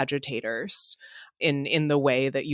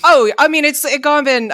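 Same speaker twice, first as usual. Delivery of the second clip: much higher and louder, amused; exasperated excitement; 11 semitones higher, 9 dB louder than usual. Real, not acted. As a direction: burst out in amused disbelief.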